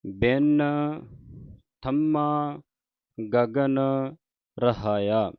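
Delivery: drawn-out devotional reading, line by line; slow scripture recitation. Shown as a demonstration. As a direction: recite it slowly like Gurbani santhiya. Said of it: "ਇੱਥੇ ਵਿਸਰਾਮ ਹੈ". (ਹੌਲੀ ਹੌਲੀ ਪਾਠ ਦਾ ਉਚਾਰਨ)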